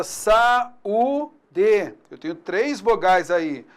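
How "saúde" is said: saúde drawn out slowly, a and ú apart; slow demo word. Shown as a demonstration. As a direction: split saúde slowly into syllables. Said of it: "nós vamos chamar de hiato".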